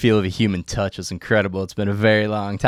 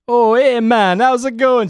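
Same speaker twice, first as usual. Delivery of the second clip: higher, louder put-on Jamaican nurse voice; mock Jamaican accent; 12 semitones higher, 6 dB louder, slower than usual. Nurse on the phone giving test results, mock Jamaican accent. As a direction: ham up a Jamaican accent, cheerful. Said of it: "super inappropriate Jamaican accent".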